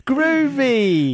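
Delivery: very high, drawn-out mock English catchphrase; Austin Powers. Co-host teases the worried actor, Austin Powers impression. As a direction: squeal it like a hammy British spy. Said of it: "just Austin Powers".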